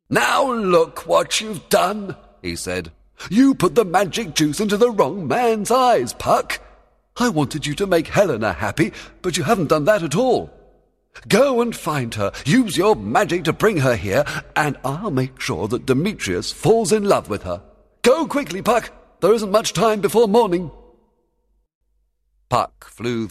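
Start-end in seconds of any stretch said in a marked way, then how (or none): 0.1-2.2 slow, high, loud scolding; angry. A narrator's reading. Fairy king rebukes his servant Puck, angry. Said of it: "Oberon pointed angrily at his servant". 3.3-20.7 high, loud scolding master; angry. A narrator's reading. Fairy king orders Puck to fix his mistake, angry. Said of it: "Oberon pointed angrily at his servant".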